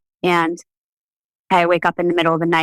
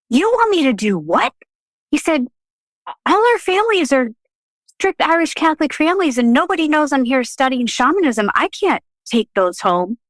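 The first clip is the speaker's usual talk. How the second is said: higher, mock disbelieving protest; incredulous friend; 8 semitones higher than usual. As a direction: voice his shock then worry. Chris shocked by the request.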